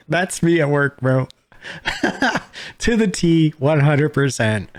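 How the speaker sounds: cracking up while agreeing; laughing. Real, not acted. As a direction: laugh through it in delighted recognition. Laughing in recognition of himself.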